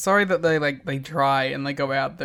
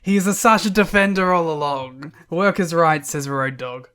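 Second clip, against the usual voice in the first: loud teasing; 9 dB louder than usual. Real, not acted. Sarcastic loud jab at co-host.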